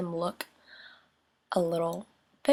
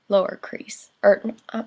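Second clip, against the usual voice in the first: stumbling, catching a quick breath; words tripping over a sudden breath; catching breath midword; 2 semitones higher, 8 dB louder than usual. Real, not acted. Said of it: "guys, I can't talk".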